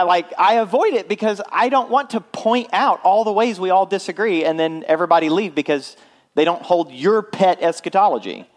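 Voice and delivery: usual speaking voice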